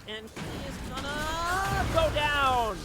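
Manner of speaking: loud, slow, stretched-out call; drawn-out hype call. Real, not acted. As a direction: stretch it out as the kill lands. Kill landing in fight.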